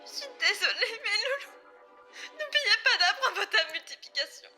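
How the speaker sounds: high-pitched, tearful apology; tearful farewell. Acted. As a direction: choke out a goodbye to your little ones. Mother's tearful farewell to her children. (adieux en larmes)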